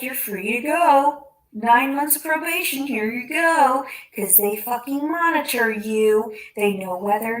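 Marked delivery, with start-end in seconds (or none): 0.0-3.9 loud, slow mock-official dismissal. Mimicking the probation office, a loud sarcastic brush-off. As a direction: parody the careless officers.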